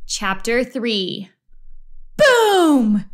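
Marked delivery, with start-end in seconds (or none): 2.2-3.1 loud, high falling boom; explosive boom. A narrator's reading. Narrator voicing the floor giving way, loud boom. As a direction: boom it out like a crash. Crash in a read-aloud story.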